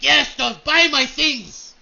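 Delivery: loud, high, very slow call; booming hawker. Acted. Dwarf calling to bowing customers, loud slow bellow.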